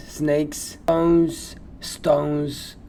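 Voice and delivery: ordinary talk, nothing marked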